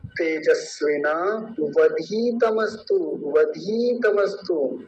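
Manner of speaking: तेजस्विना वधीतमस्तु read slowly; full word recitation. Shown as a demonstration. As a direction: recite the whole phrase slowly. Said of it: "तो पूरा शब्द". (पूरा शब्द धीरे से)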